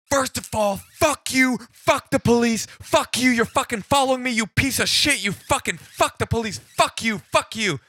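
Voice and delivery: hammed-up furious yelling; enraged caller. Angry caller cursing at the police officer, screaming. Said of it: "He's just like".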